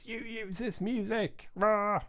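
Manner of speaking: high mock outraged voice; mock angry YouTube complaint; mock angry email. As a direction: play a cartoon copyright cop. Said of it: "YouTube doesn't start sending me angry emails".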